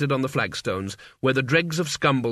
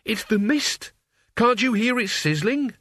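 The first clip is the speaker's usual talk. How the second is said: frightened; 8 semitones higher than usual. Acted. Mort sees the mist.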